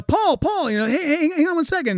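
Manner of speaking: high, hurried mock calling; acted flustered pleading; flagging someone down. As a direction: call after a departing star. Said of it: "and he's like, uh".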